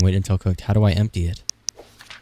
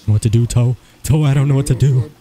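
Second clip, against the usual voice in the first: higher and louder panicked plea; freaking out; 5 semitones higher, 5 dB louder than usual. Real, not acted. Panicking worker calls to friend Toe, frantic. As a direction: cry out to your friend for help. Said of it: "I'm freaking out".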